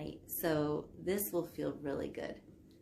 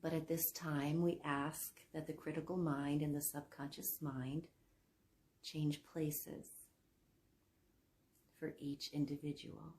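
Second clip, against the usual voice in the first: guided sleep meditation. Acted. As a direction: guide them down into sleep, softening as phrases end. Leads hypnosis for sleep.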